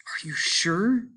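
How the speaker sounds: high, hushed, slow and uneasy; tense whisper. A narrator's reading. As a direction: whisper it, nervous and tight. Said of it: "His wife sounded peculiarly tense".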